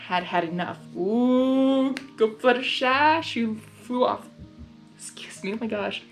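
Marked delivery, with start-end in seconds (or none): none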